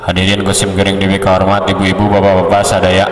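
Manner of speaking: run-together formal salutation; hasty, muddled audience greeting; too hurried. Shown as a demonstration. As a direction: rush the address without spacing the phrases. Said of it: "Gencang teuing mah".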